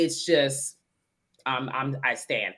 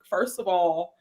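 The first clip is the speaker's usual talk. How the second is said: usual speaking voice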